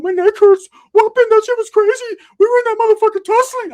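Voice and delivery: a shrill imitation of the friend; Ricky's voice. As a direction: squeal it like Ricky. Ricky after bathroom fight.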